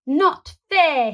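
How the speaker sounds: high, loud whiny complaint; sulky protest. A narrator's reading. Boy whining to granny about bedtime. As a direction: whine it like a cross child.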